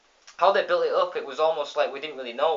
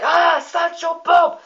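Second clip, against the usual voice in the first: high, loud, slow scared cry; kids' shriek; 9 dB louder, slower than usual. Retold or imitated. The kids spotting Bob in the cinema, shrieking. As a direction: shriek the name in horror. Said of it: "the kids are like".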